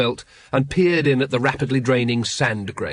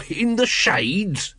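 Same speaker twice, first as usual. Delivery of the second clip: slow, high, choked-up wheeze; wheezing; 8 semitones higher, much slower than usual. A narrator's reading. Nobby choking on smoke, wheezing at Carrot. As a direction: gasp it out after choking on smoke. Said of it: "he wheezed".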